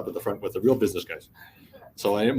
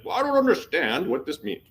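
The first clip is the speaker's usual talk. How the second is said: lightly imitated French accent, pitched up; 7 semitones higher than usual. Security officer phoning about the report, puzzled French accent. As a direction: put on a hint of a French accent. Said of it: "phones me in his French accent".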